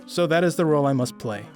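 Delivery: ordinary delivery, nothing marked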